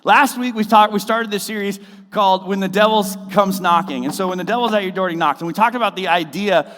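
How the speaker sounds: preaching at full force. Acted. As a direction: preach it out, rising.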